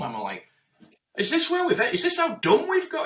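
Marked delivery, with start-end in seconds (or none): 1.2-3.1 much higher, louder, in disbelief; incredulous. Real, not acted. Hearing "tidy your room" advice, high incredulous outcry. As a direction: throw it up in exasperation.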